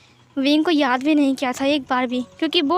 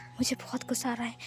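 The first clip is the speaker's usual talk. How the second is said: much softer, fading words; faint angry murmur; 2 semitones lower, 13 dB quieter than usual. Acted. Wing, eyes closing under the locket, murmuring much softer. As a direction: let the anger sink to a faint murmur.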